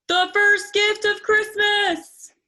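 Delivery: slow, big, high proclamation; called out. Acted. Santa holding up the bell, calling out loudly. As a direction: announce it to the whole crowd. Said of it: "and called out".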